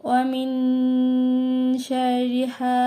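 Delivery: measured recitation. Shown as a demonstration. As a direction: chant it evenly. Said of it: "recites the ayah". (তিলাওয়াত)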